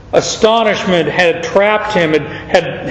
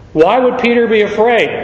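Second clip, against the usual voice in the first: normal talking voice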